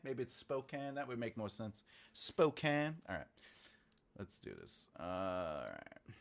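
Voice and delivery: ordinary talk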